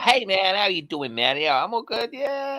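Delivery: high, laid-back surfer dude; California surfer. Retold or imitated. Brit imitating a laid-back California dude. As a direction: chill California dude drawl. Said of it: "California's a bit more".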